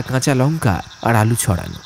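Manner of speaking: plain reading voice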